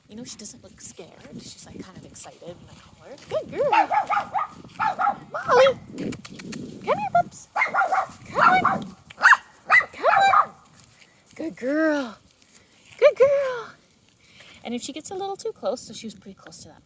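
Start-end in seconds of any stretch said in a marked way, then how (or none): 3.3-13.7 very high, sing-song calling; calling the dog back. Real, not acted. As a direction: coax the pup back with bright calls.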